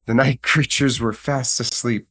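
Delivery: plain reading voice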